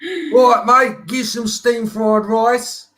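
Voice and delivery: high, loud, slow English geezer; hammed-up English accent, drawn out. Shown as a demonstration. Showing off for Monica, exaggerated English accent. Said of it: "do it in an English accent".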